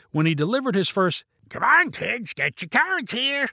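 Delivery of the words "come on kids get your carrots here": high, weird cartoon monster voice; raspy mix of creature and cartoon vampire; Gollum and Count Chocula. A narrator's reading. Vinny coaxing party kids to eat carrots, weird raspy voice. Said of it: "like a mixture of Gollum from Lord of the Rings and Count Chocula".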